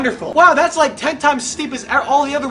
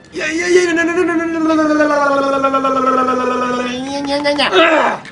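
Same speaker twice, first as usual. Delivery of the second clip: frantic panic; 2 semitones higher, faster than usual. Real, not acted. Player about to fail, panicked babbling.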